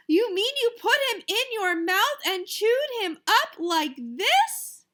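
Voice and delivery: horrified. Acted. Mother horrified, voiced.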